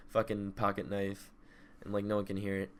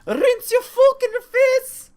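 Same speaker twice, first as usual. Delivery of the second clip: high, loud, slow mock screaming; hammed-up yell at Tiff; 14 dB louder, slower than usual. Mocking Tiff's order to rinse, high hammed-up yelling. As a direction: scream it like a furious mangled order. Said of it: "I was doing".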